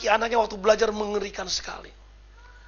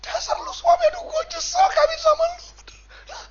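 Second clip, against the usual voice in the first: high-pitched complaining wife impression; hammed-up wifely scolding, pitched way up; nagging wife. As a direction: moan at the husband in a high voice. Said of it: "kalau ngomong ke suaminya, aduh, saudara, mengerikan".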